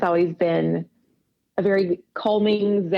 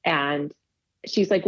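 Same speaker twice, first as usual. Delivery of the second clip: usual speaking voice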